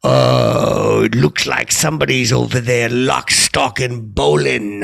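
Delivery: loud, slow PA announcer voice; loud PA announcement to the whole bowling alley; loudspeaker announcement. Retold or imitated. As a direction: boom it out over the loudspeaker. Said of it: "I jump on the loudspeaker".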